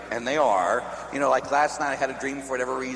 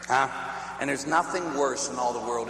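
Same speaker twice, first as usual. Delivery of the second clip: ordinary delivery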